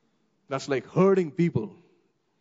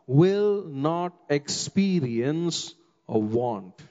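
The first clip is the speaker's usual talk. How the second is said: usual speaking voice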